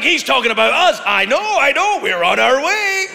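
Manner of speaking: high, loud eager shouting; eager Canadians. Retold or imitated. Canadians thrilled to be mentioned, eager loud shout. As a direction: call out thrilled to be included. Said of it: "It's just like".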